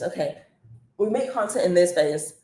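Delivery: usual speaking voice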